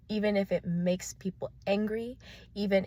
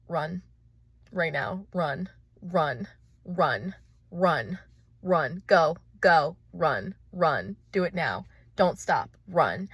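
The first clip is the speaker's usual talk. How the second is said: urgent; 6 dB louder, much slower than usual. Acted. Inner voice commanding to run.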